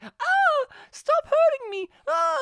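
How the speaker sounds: very high, loud fake pained squeal; hammed-up high-pitched victim cry; falsetto mock cries. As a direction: squeal it like a badly acted victim. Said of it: "she's like".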